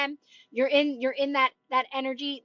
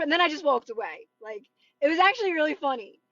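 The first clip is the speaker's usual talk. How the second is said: ordinary talk, nothing marked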